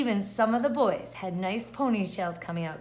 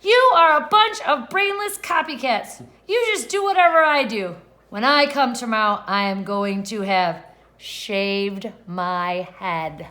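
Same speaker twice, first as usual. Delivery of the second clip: angry yell; 8 dB louder than usual. Acted. Stephanie yelling at copycat classmates, furious. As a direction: shout them down, then drop the threat slowly. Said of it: "Stephanie yelled".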